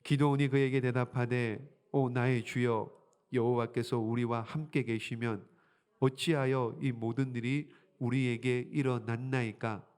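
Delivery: low, measured reading; scripture reading. Acted. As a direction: read the verse aloud solemnly.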